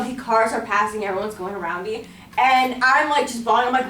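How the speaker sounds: ordinary delivery, nothing marked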